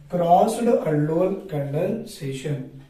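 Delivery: slow, deliberate teacher dictation. Shown as a demonstration. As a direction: spell it out slowly for notes. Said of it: "Sir, it is called what".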